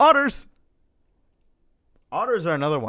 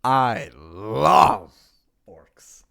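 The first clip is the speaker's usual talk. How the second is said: put-on stronger Death Wolf accent, dropping deep on the last word; 3 semitones lower, 10 dB louder, much slower than usual. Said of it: "pull out the Death Wolf accent".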